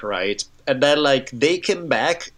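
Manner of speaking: usual speaking voice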